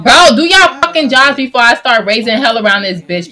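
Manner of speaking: loud furious demand at the medics; 1 angry yell. Pretend. Medics stalling while he's hurt, yelling furiously. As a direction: yell it like you'll raise hell.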